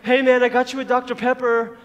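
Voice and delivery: hammed-up friendly setup; imitating teasing friend. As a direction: put on a goofy pal making an offer. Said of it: "they would be like".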